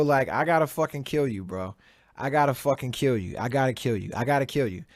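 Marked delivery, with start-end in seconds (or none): none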